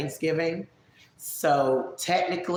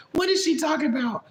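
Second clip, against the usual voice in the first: high mock-baffled voice; lightly acted bewilderment; puzzled onlookers; 9 semitones higher than usual. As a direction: quote confused listeners in a raised pitch. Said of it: "people that don't know".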